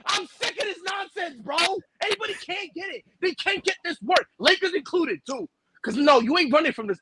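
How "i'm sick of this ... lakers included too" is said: hyped bragging. Acted. Boasting about the Celtics.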